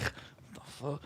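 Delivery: breathless unvoiced whisper, slow; whispered what the fuck. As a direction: whisper it in shock, drawn out. Waking at four confused.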